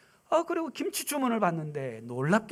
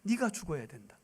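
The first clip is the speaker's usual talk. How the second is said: much softer, lower, weighty; hushed solemn pronouncement; grave hushed voice; 4 semitones lower, 7 dB quieter than usual. Retold or imitated. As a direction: speak God's verdict quietly. God's words repeated.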